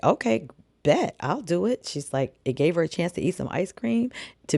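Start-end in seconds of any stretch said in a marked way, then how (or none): 0.0-1.9 higher-pitched, swooping eager yes; game acceptance. Retold or imitated. Writer accepts boss's ice cream dare, game and high. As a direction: act out taking up a dare, pitched up. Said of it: "So she's like".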